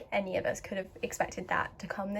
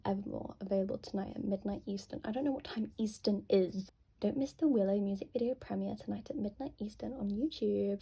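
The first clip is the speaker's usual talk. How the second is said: ordinary talk, nothing marked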